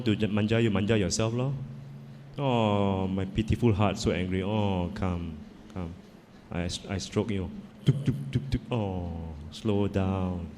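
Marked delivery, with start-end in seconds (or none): 2.4-10.5 tender self-soothing. Shown as a demonstration. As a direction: gently hush your own hurt like a child's. Stroking and soothing own heart.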